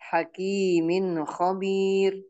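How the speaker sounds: slow recitation, short N ending "Hakimin"; no hum on "Hakimin"; correct clear nun. Shown as a demonstration. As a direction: go straight from "Hakimin" into "khabir". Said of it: "yang benar adalah".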